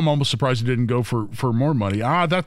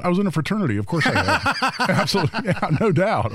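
laughing through the words; chuckling while answering; 6 semitones higher than usual. Real, not acted. Laughing at fraternity joke.

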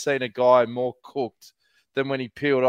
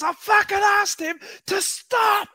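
imitating a furious boss, much higher and slow; hammed-up outrage at the rider not stopping; squeaky mock rage, dragged out; mock furious yell; slower than usual. Retelling the team boss's outburst.

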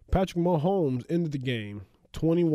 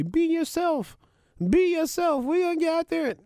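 much higher, hyped-up locker-room pep talk; bad Patrick Mahomes. Cohost does a bad Patrick Mahomes pep talk, higher pitched and hyped. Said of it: "Is that a bad Patrick Mahomes?".

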